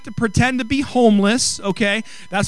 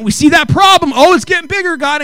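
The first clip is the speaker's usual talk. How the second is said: high, loud and urgent; loud preaching; 6 semitones higher, 6 dB louder than usual. Acted. Pastor preaching about problems growing bigger, loud urgent cry. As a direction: cry it out to the church.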